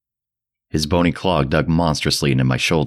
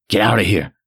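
higher, louder terrified urging; louder panicked repeat; 6 semitones higher than usual. Acted. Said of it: "albeit as a trembling whisper".

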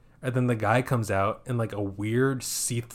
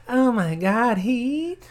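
much higher, giddy and thrilled; giddy squeal; 13 semitones higher, 6 dB louder, much slower than usual. Retold or imitated. Horny girl squealing at the farmer about the heat.

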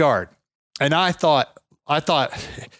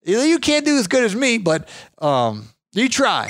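higher voice showing off his yard; proud old man; 9 semitones higher, slower than usual. Retold or imitated. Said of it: "He's like".

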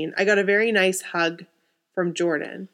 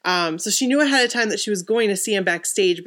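ordinary talk

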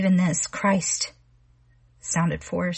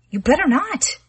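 much higher, loud frightened yell; panicked shout; 9 semitones higher, 6 dB louder than usual. Acted. Connie yells at Arnold outside the house, frightened yell. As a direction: scream it at him in terror.

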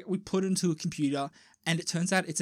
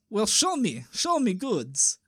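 slowing, put-on Russian criminal voice; Russian accent; 2 semitones higher, slower than usual. Russian criminal demanding to see the goods, Russian accent. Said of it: "the Russian guy goes".

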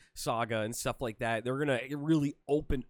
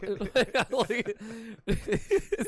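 high giggle; 9 semitones higher than usual. Real, not acted. Laughing at his own bit.

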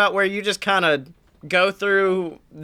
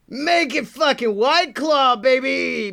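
Jersey Dan voice; 5 semitones higher than usual. Pretend. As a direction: hype it up like a rowdy Jersey guy. Voicing Jersey Dan on phone.

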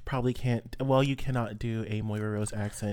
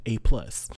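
louder punchline in a mock European accent; mock European professor grading on TV; fake European accent; 6 dB louder than usual. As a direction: land the verdict proudly in a fake European accent. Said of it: "in a fake European accent".

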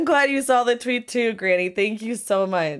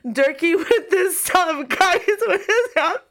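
overwhelmed, high-pitched and tearful; real tearful disbelief; about to ugly cry; 6 semitones higher than usual. Real, not acted. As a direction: voice climbing as happy tears take over. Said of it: "I'm gonna ugly cry".

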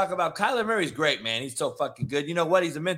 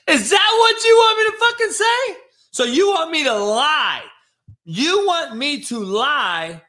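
high, loud, laughing yell, slowing at the end; laughing shout; 9 semitones higher, 7 dB louder than usual. Real, not acted. Host yelling at critical fans, laughing and loud. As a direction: blow up at them, half laughing. Ranting at fans on his show.

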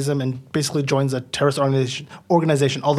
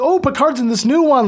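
much higher, pleased discovery; mock excited viewer; 10 semitones higher, 3 dB louder than usual. A viewer spotting Picard in the tie-over episode, excited. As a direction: act out a fan's perked-up interest.

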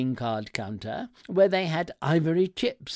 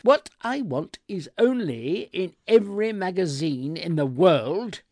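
loud, high exasperated rant, slowing at the end; lost temper; 5 semitones higher, 7 dB louder than usual. A narrator's reading. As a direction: rail at the assistant, dragging out the last words. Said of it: "I did lose my temper".

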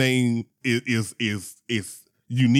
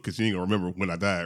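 usual speaking voice

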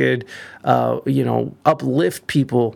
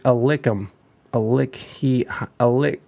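usual speaking voice

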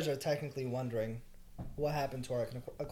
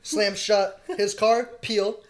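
ordinary talk, nothing marked

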